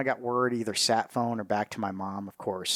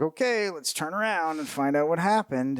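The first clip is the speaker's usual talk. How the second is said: urgent callout; 6 semitones higher, 3 dB louder than usual. Retold or imitated. Soldiers deciding on the radio call.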